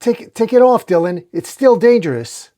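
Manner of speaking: high, loud urgency; alarmed warning. A narrator's reading.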